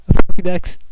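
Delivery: high, loud, near-tears joy; hammed-up gush over a gift; overjoyed squeal. Player receiving a Pokedex, mock near-tearful joy. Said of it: "gonna burst into tears any second here".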